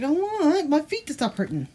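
a hint of whiny pet voice; voicing the dog. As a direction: speak for the sore-footed dog, slow and a little high. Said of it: "He's like".

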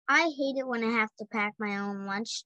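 ordinary delivery, nothing marked

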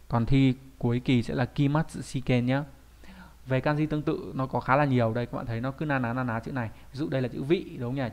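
normal talking voice

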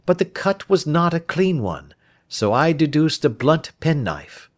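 crisp detective laying out his deduction; a hint of a keen, lecturing Holmes; Holmes explaining.

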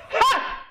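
sharp, high exclamation; loud high yelp. Real, not acted. Streamer reacting to a bad play, loud yelp. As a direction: burst out with a loud high cry.

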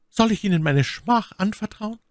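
high, shamed lady's question; ashamed woman. A narrator's reading. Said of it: "das Antlitz mit Schamröte übergossen". (My Lady fragt Felton beschämt, mit hoher Stimme)

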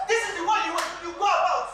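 ordinary talk, nothing marked

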